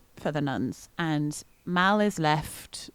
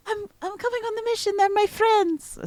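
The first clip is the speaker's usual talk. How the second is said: much higher put-on accent that is not Mal's usual one; wrong accent. Player quoting Mal to the group, in a higher wrong accent. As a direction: slip into the wrong accent for Mal, eager and quick. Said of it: "not in that accent".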